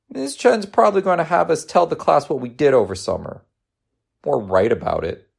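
put-on high-pitched child fretting; anxious kid voice; worried young boy. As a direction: dread it like a schoolboy.

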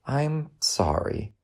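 soothing motherly sorry; tender apology. Acted. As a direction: apologise gently and slowly. Mother consoling upset son.

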